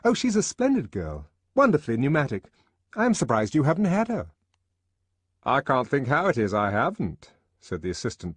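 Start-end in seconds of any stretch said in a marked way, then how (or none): 0.0-4.3 high, loud, breezy male colleague; raised, lively endorsement; enthusiastic recommendation. A narrator's reading. Henry recommends Lenina to colleague. 5.4-7.3 raised, louder character voice; hearty, higher-pitched reply; puzzled colleague. A narrator's reading. Colleague answers Henry.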